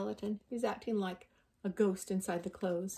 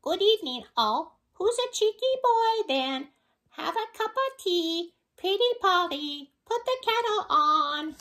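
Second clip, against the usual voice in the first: much higher, louder squawky parrot voice; 12 semitones higher, 8 dB louder than usual. Acted. Parrot skeleton greets the visitors, much higher parrot voice. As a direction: chatter like a cheeky pet parrot. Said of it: "the parrot skeleton".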